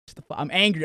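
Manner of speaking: ordinary delivery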